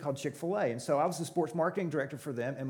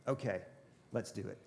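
low, soft, understated go-ahead; quiet retold agreement; 8 semitones lower, 10 dB quieter than usual. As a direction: imitate a boss softly giving in. Retells boss quietly agreeing to fund it.

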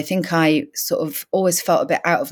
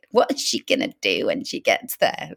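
doubtful townsfolk; 9 semitones lower than usual. Retold or imitated. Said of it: "everyone's like".

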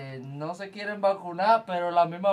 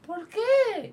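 mock outrage.

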